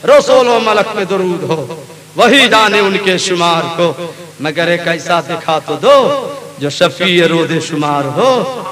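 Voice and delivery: usual speaking voice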